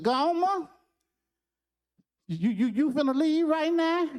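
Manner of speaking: put-on high, small child's voice; a kid who doesn't want to be left alone; boyish, timid and high; little boy. Child asks Gomer to stay.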